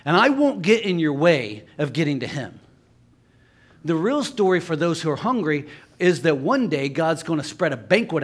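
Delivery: emphatic preaching, rising and falling in waves; impassioned preaching. Real, not acted. Preacher telling listeners God will feed them forever, fervent preaching. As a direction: drive each point home to the crowd.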